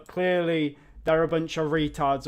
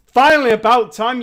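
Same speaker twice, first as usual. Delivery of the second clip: loud, higher exasperated shout; angry outburst; 6 semitones higher, 13 dB louder than usual. Real, not acted. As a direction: explode at the company. Rant at the game company.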